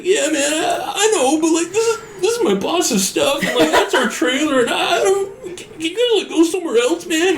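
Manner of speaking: high strained pleading voice, way up; whiny guard. Retold or imitated. Guard begging the homeless couple to leave, high and whiny. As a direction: beg them to leave in a squeaky voice.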